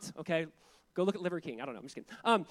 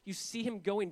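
normal talking voice

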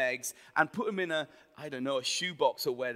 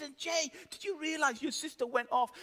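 high-pitched imitation of mum; mock motherly dismay; reproachful mum; 10 semitones higher than usual. Voices mum telling him off.

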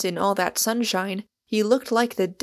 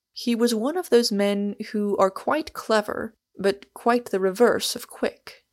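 ordinary delivery

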